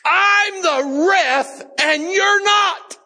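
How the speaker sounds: high, drawn-out full-voiced scream; indignant ref shouting, stretched and high; retold scream. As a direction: scream it out like an angry referee. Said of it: "he screamed at me".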